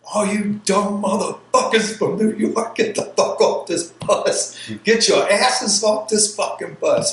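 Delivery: high, loud yell with a rasp at the end; drill instructor yelling. Retold or imitated. Drill instructor yelling at New York recruits on the bus. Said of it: "he goes".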